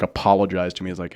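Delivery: ordinary talk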